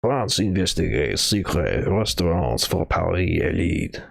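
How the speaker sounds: "France investigates" in a slow, hammed-up French accent; shitty French accent. Streamer rereading the headline in a fake French accent. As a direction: read the headline like a bad Parisian. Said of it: "in a shitty French accent".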